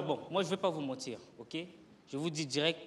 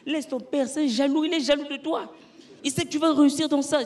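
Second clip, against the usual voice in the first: encouraging mother; 8 semitones higher, 6 dB louder than usual. Retold or imitated. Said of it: "Ma mère me dit".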